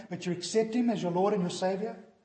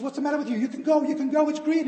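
higher, louder pushing to go; impatient urging; 9 semitones higher, 8 dB louder, faster than usual. Retold or imitated. Passenger urging the stopped driver to go, impatient. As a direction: hurry him along.

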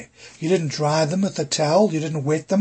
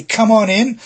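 warm welcome; 5 semitones higher, 6 dB louder than usual. Shown as a demonstration. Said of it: "you open the door wide, you say".

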